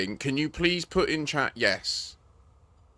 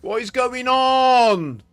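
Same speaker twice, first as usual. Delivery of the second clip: slow, drawn-out angry yell, much higher and louder; furious shout; 10 semitones higher, 7 dB louder, much slower than usual. Real, not acted. Gamer shouting at a broken game lobby, furious shout. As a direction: bellow it out in real frustration. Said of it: "I'm getting pissed off".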